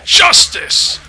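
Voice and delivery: loud, slow battle cry; hammed-up hero shout. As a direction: proclaim it like a superhero.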